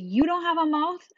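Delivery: loud, high indignant question; scolding teacher. Retold or imitated. Teacher to pupil who didn't answer, indignant scolding. Teacher snaps at silent child.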